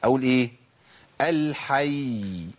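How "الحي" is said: الحي stopped on a long held y; lengthened final ya. Shown as a demonstration. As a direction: stretch the last sound of الحي very slowly. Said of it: "لازم أطول الزمن شوية".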